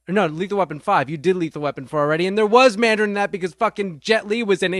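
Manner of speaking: normal talking voice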